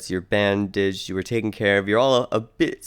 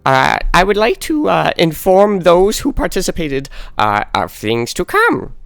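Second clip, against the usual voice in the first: much higher, louder fussy announcer voice; gnome announcer; 10 semitones higher, 7 dB louder than usual. Acted. Sweaty gnome announcer introducing himself at the door, high and loud. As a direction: squeak it out like a flustered little gnome. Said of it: "a voice at the door say".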